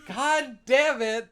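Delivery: loud, high, drawn-out outburst; hammed-up slow exasperated yell; mock exasperation. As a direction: holler slowly after fleeing kids. Acted outburst as kids run off.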